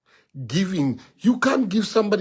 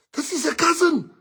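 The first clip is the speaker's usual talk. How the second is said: high begging voice, much higher; pleading lovestruck friend; 11 semitones higher, 3 dB louder than usual. Retold or imitated. Friend begs teller to stay out of his business, high pleading. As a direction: beg like a man blinded by love.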